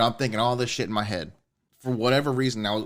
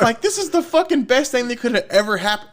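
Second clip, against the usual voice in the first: much higher, louder, gushing excitement; overjoyed; 11 semitones higher, 5 dB louder than usual. Retold or imitated. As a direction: burst with happiness at the news. Retelling joyful reaction to pregnancy.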